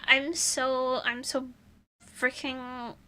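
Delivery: ordinary talk, nothing marked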